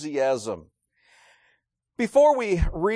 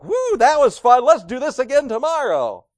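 louder, higher, carefree whoop; mock cheery; 6 semitones higher, 6 dB louder than usual. Preacher mocks beaten apostles leaving happy, cheerful. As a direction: toss it off like a fun ride. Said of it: "walked out of there like".

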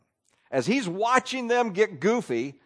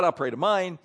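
usual speaking voice

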